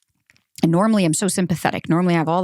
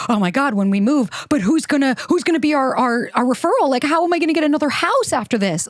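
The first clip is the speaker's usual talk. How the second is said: anxious worrying; 7 semitones higher than usual. Retold or imitated. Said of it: "I was like".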